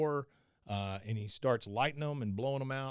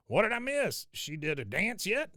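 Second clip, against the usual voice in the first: Cotton voice; 8 semitones higher than usual. Retold or imitated. Cotton voiced retelling episode.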